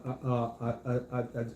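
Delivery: ordinary delivery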